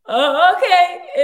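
laughing through a high, loud mock reply; laughing imitation. Retelling Keanu's reply while laughing loudly. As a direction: mimic him while cracking up. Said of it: "he was like".

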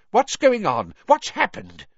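high, loud alarmed questions. A narrator's reading. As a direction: panic at the sight. Fudge panics over the scene.

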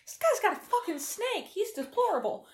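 mock outrage. Real, not acted.

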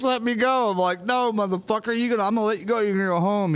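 high, heated replay of a schoolyard scuffle; retold fight shouting. Retold fight, pleading and defiant.

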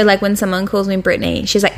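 ordinary talk

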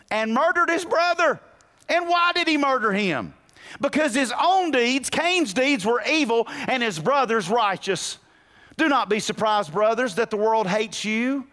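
high, loud fiery preaching of scripture; preaching at full force. Acted. Preacher drives home murder versus love, preaching hard. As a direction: let the verses ring out through the church.

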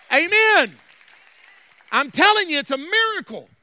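rising into loud, driving church preaching; building preaching. Real, not acted. Pastor promising deliverance to congregation, building preaching. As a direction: build phrase on phrase to full force.

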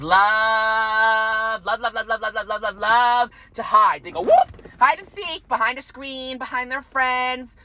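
slow, stretched, taunting sing-song; mocking sing-song. Mocking gossips who hide, stretched and taunting. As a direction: drag out the words to mock the gossips.

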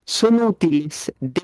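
slow, deliberate read-aloud; slow rereading. Shown as a demonstration. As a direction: stretch the words as you read. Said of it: "Volvelo a leer lentamente".

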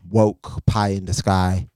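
ordinary talk, nothing marked

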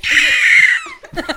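high piercing bird screech; shrieking giant bird. Pretend. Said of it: "That's my eagle impression".

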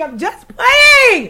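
high, slow squealing I'm just playing; squealed teasing. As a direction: squeal it out playfully, stretched long. Teasing after porn joke.

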